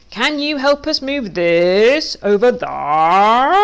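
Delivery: slow, loud, stretched bleating sheep voice; sheep speak. Acted. Duck asks the sheep for help in slow loud bleats. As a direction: drag every word out like a lazy sheep.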